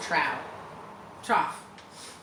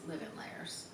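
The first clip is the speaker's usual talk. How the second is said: whisper; 3 semitones lower, 15 dB quieter than usual. Real, not acted. Spooky aside about villains.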